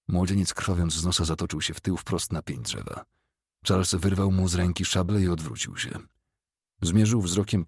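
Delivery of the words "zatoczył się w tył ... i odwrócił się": plain reading voice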